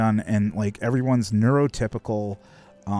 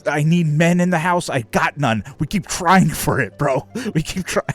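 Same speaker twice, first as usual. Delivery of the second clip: raised, louder imitation of a fed-up dad; mock exasperated rant; 6 semitones higher, 4 dB louder than usual. Dad venting to store clerk about daughters, acted exasperation. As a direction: build into a loud, pleading mock rant.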